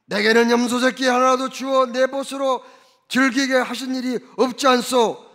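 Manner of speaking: elder son loudly confronting his father; indignant protest. Retold or imitated. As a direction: press the grievance hard. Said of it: "이렇게 따진 것은".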